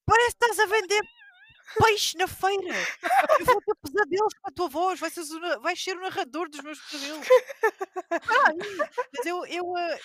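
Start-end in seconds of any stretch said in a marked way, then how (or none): 0.0-7.3 high, loud teasing scold; playful teacher shushing a noisy pupil, louder; mock telling off. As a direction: tease the kid loudly, half joking. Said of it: "E assim".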